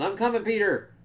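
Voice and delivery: high-pitched, restrained retold call; mock yell. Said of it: "someone's yelling".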